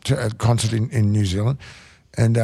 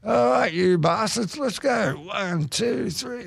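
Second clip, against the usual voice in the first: much higher, slow, slurred "All right, you bastards" fading softer into the count; mock drunk singer launching the band, trailing off quiet; drunk rocker count-in; 10 semitones higher, 3 dB quieter, much slower than usual. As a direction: lurch into the count-in like a plastered frontman.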